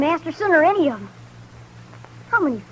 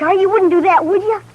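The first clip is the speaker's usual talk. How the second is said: put-on higher and louder, a boy begging urgently; anxious pleading; 6 semitones higher, 6 dB louder than usual. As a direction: plead fast before he can say no. Boy begs not to go back.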